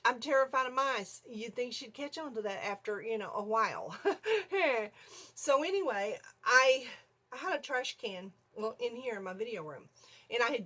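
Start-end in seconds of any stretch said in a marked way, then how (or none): 4.0-4.9 high burst of laughter. Real, not acted. Laughing at her own fear.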